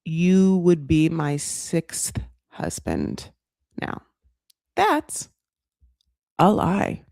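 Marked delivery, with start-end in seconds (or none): 0.0-4.0 drawn out, each word weighed; mock solemn slow admission; slow confession. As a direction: confess it slowly and carefully. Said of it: "She's like".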